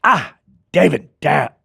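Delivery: high, loud, raspy snarl of a curse; sudden Freddy snarl at the makeup artist. Retold or imitated. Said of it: "it just stuck in my throat".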